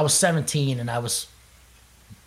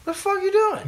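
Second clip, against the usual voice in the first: mock disbelief. Mocking his own confusion.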